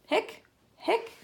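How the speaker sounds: high, rising imitation hiccup; acted hiccups. A narrator's reading. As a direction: hiccup in short, high bursts. Story character has hiccups.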